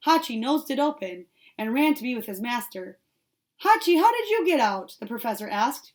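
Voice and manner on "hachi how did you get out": high, loud startled question; surprised. A narrator's reading. Professor finding his puppy out the gate, high loud surprise. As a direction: catch the dog out in surprise. Dog escaped through gate.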